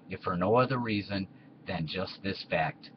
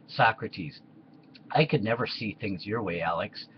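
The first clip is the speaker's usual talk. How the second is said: normal talking voice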